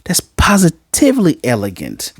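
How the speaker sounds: lightly voiced astonished teacher; shocked teacher thinking. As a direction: marvel at her in your head. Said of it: "He's like".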